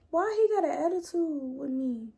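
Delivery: high, bewildered question; baffled disbelief. Retold or imitated. Asking her friends why he's hostile, baffled. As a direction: sound thrown by his tone. Retelling her shocked reaction.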